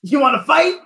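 mock Goku challenge.

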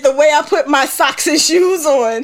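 high, tearful laughter; barely talking through tears of laughter; laugh-crying. Real, not acted.

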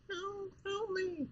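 very high, soft, slow faraway plea; tiny trapped creature crying for help; tiny distant voice. Retold or imitated. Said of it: "those exist within this water".

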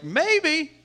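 high, loud, lilting "maybe"; comic high-pitched shrug of an answer; noncommittal Paul. Retold or imitated. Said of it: "Paul's answer is like".

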